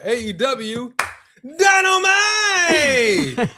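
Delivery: Dynamite! stretched out, high and shouted; announcer yell. Shown as a demonstration. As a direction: roar out Dynamite! long and slow like a ring announcer. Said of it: "Wait, I gotta say it right".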